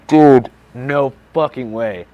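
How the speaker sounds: loud, slow, emphatic refusal; disbelieving protest. Retold or imitated. Retold protest at being put away.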